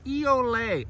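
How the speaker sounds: high, sung bird call; thrush call. Acted. Narrator imitating a wood thrush's song, high and sung. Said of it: "a wood thrush sings".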